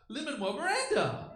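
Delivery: high, slow, thrilled announcement of the name; excited reveal. Acted. Comic imitating a kids' show revealing a surprise guest, excited. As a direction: cry out the surprise guest like a kid seeing a star. Celebrity pops up among Muppets.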